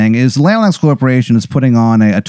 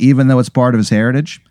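normal talking voice

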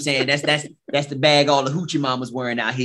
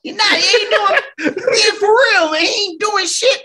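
laughing; 5 dB louder, slower than usual. Real, not acted. Laughing disbelief at wrestler.

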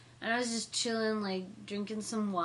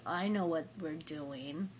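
lower, softer and slow, knowingly; quietly slow, letting the discovery sink in; hushed realization; 7 semitones lower, 7 dB quieter, slower than usual. Retold or imitated. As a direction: murmur it as the penny drops. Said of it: "and I'm like".

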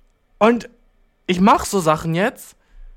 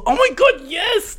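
high, gushing burst of delight; excited agreement; 13 semitones higher, slower than usual. Acted. Agreeing with a friend's advice, excited agreement. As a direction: exclaim it like a thrilled revelation.

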